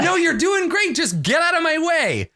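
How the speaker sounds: high, exasperated mock yell; acted high-pitched irritation; shouting in blame. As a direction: holler at a teammate to move. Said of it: "she shouts at you".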